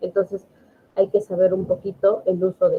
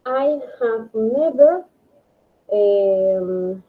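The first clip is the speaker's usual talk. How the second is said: slow model reading; 2 semitones higher, much slower than usual. Shown as a demonstration. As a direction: read the example slowly and clearly. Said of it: "Por ejemplo". (lectura lenta del ejemplo en inglés)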